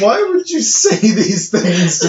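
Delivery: high, loud, slow laughing protest; laughing outrage. Real, not acted. Laughing as she scolds her cohost for the joke. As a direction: squeal it out through laughter.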